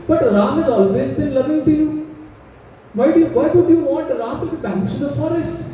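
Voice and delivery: reasoning plea. Acted. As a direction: reason with her, bewildered. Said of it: "the logic he uses".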